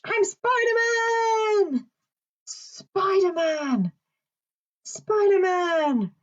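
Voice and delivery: very high, drawn-out heroic shout; Spider-Man. Pretend. Presenter in a mask announcing himself to children, pretend hero shout. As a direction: call it out like a costumed hero arriving.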